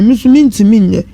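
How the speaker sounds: ordinary delivery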